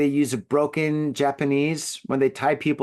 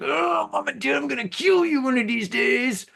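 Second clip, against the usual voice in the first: high-pitched, exasperated imitation of a teenager; hammed-up adolescent grumbling, pitched way up; mock teenage outburst; 10 semitones higher than usual. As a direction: whine in pretend fury at your parents. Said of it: "our parents are giving us crap".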